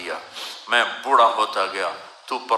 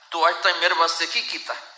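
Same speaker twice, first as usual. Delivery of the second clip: fast, raised reproach in Punjabi; mocking son; 5 semitones higher, faster than usual. Son taunting his father, fast and reproachful. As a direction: fling it at the father quickly. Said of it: "ایک بچہ اپنے باپ سے کہہ رہا تھا".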